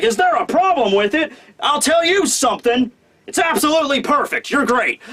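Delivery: hammed-up old foreman gushing praise; gruff foreman turned praising. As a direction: build up like a scolding, then gush. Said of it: "Minatomo's like".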